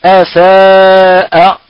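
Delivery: أساء drawn out slowly with a light, thin hamza; tajweed recitation. Shown as a demonstration. Teacher reciting أساء slowly for students, hamza light. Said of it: "كقوله تعالى".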